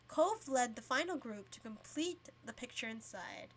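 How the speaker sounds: plain reading voice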